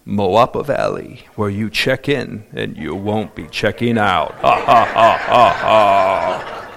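low, slow, hushed horror-host voice; sinister welcome ending in a deep villain laugh; Vincent Price. Acted. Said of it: "hear in my mind Vincent Price's voice".

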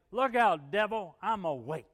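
loud warning, high at the start; defiant challenge. Retold or imitated. Wild friend greeting the devil on waking, defiant shout. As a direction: call the devil out with attitude. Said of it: "He had an attitude about it".

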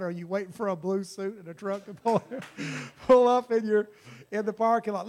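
amused question broken by real laughs; laughing. Real, not acted. Teasing the man about the parking lot, laughing.

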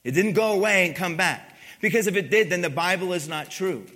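loud, emphatic insistence; loud preacher's rebuttal; forceful preaching. Real, not acted. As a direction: drive the point home to the congregation.

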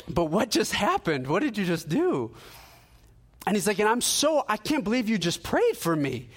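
imitating a friend who can't believe it; retold wonder; stunned amazement.

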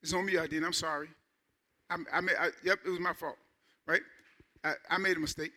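soft, low, sorry to his wife; humble apology. Retold or imitated. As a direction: say sorry gently and own it. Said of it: "you know what I tell her?".

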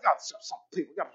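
low, soft grumbling imitation; half-formed mock cursing under the breath; muttering under breath. Woman gathering her stuff to board, muttering. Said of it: "muttering, cursing underneath her breath".

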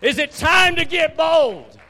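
very high, drawn-out preacher's yell; full-force rallying shout. Real, not acted. Preacher challenging the congregation to get bold, huge shout. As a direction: throw the question out slowly at the top of your voice.

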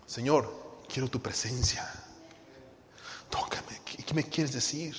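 muy bajo, casi susurrado; háblale a Dios en voz baja, con pausas; oración íntima. Acted. Acts out intimate prayer to God. (oración susurrada)

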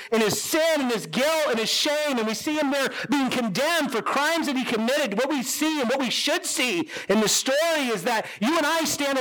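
preacher urging the congregation to see themselves as Barabbas, impassioned; impassioned preaching. Acted. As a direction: preach it to the whole room. Preaching at full force.

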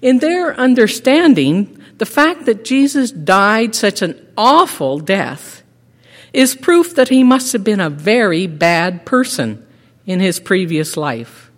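ordinary talk, nothing marked

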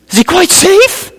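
hammed-up girl's squeal; squeaky frightened girl. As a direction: squeak it out like a scared child. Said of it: "He goes".

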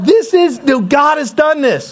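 high-pitched, exasperated insistence; distressed protest. Retold or imitated. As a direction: protest as if it is slipping away. Retold distress as rival inspects land.

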